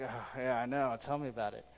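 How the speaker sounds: low, quick mock agreement, thrown away under the breath; a dry tell-me-about-it from the pews; mock-weary congregant muttering; sarcastic aside. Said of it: "you're like".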